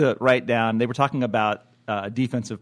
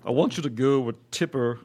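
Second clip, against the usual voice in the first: Al Gore impression. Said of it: "Al Gore".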